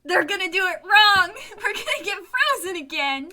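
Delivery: laughing. Real, not acted. Player exasperated laughing.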